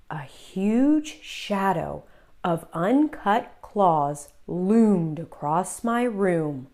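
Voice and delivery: ordinary delivery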